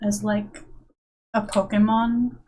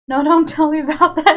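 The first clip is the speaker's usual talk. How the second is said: loud, fast playful protest; louder, faster no don't; mock panic; 4 semitones higher, 7 dB louder, faster than usual. Real, not acted. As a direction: beg them to stop, half laughing. Chat warns yawning is contagious.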